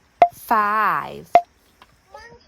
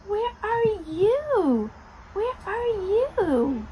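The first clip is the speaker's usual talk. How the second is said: playful searching call; 8 semitones higher than usual. Pretend. Playful hide-and-seek with a child.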